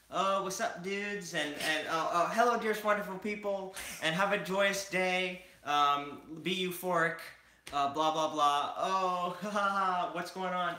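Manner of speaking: cheerful, hyped-up mimic of a friend; Oliver impression. Imitating cohost Oliver, high and bubbly. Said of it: "this is my best Oliver imitation".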